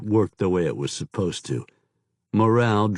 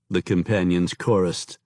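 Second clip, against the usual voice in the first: plain reading voice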